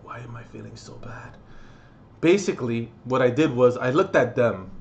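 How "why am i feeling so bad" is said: whispered, much softer; hushed self-question. Retold or imitated. Recalling near tears at the concert, whispered. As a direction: breathe it out barely audible. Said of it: "I almost wanted to cry".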